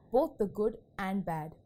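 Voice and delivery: ordinary delivery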